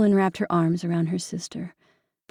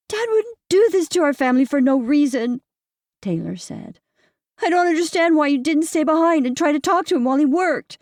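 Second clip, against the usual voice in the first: put-on high pleading girl voice; upset teen girl; 8 semitones higher, 4 dB louder than usual. A narrator's reading. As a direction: plead like a hurt daughter. Daughter Taylor voiced.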